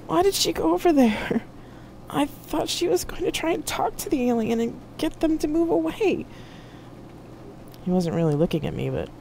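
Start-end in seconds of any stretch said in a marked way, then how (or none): 0.1-6.2 high-pitched, grieving protest; distraught. A narrator's reading. Jack asking why Marcia went over, distraught. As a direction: question it in high, helpless distress.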